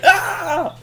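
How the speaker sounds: high, loud surprised yell; mock startled scream. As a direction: scream as if caught off guard. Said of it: "they get surprised again".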